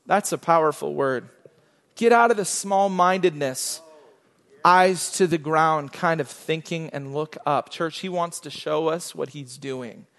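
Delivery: normal talking voice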